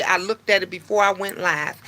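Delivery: ordinary talk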